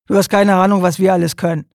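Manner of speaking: loud, defiant retort; defiant shout. Acted. Worker defies the police officer, loud and defiant. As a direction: throw it back at him loudly.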